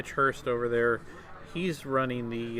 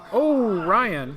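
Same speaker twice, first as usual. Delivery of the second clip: surprised; 9 semitones higher, 10 dB louder than usual. Real, not acted. Correcting the player's name mid-broadcast.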